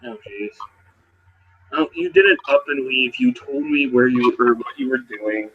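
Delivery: ordinary delivery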